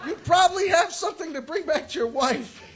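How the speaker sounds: loud, high laughing retort; laughing shout. Retold or imitated. Comic yelling at the friend about his wife, loud and laughing. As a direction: yell the warning while laughing. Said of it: "I'm like".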